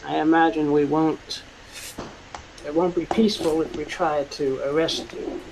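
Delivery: ordinary talk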